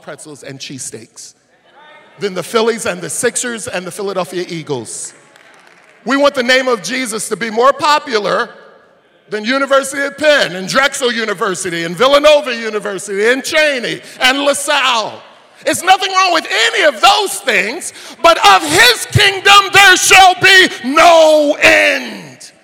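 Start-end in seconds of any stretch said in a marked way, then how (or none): 2.2-5.1 loud, rising preacher's cry over the music; preaching at full force. Acted. Pastor rallying the congregation, full-force preaching. 6.0-8.5 higher and much louder, punching want; loud preaching. Acted. Pastor repeating his line to the crowd, now shouting. 9.3-22.5 loud, rising preacher's cry over the music; preaching at full force. Acted. Pastor rallying the congregation, full-force preaching.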